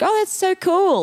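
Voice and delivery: high, drawn-out mock gush; impressed gushing. Retelling reaction to hearing of shared parties, high and gushing.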